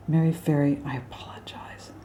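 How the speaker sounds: soft whispered aside; whispered apology. Real, not acted. Teacher whispering an apology to alto Mary Ferry. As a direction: whisper a sheepish sorry.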